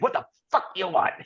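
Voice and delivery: lightly imitated gruff stage manager. Stage manager greeting a stranger who walks over, mock gruff. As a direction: play at being a curt, gruff crew boss. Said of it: "gruff stage manager".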